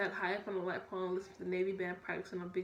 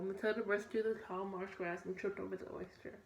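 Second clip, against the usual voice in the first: ordinary delivery, nothing marked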